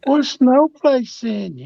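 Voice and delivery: slow, high teasing call; teasing high drawl. Real, not acted.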